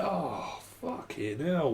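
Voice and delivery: soft mock groan of dismay; acted muttered exasperation; weary exasperation. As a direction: sigh it under your breath, fed up. Acts reaction to being interrupted.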